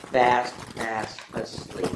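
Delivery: sleepy. A narrator's reading. Story ends, hedgehog falls asleep.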